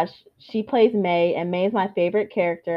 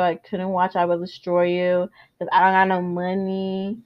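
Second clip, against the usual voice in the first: usual speaking voice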